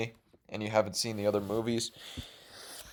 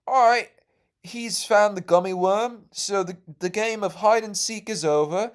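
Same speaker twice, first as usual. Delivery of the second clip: higher, louder announcing lady voice; counselor lady; 8 semitones higher, 3 dB louder than usual. Retold or imitated. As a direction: announce the end of the game to the kids. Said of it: "the counselor lady was like".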